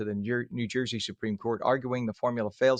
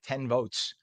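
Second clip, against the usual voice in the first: ordinary delivery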